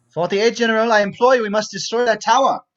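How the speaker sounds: officer urgently pressing his superior; urgent pleading. Acted. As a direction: implore your general forcefully. Officer implores the general.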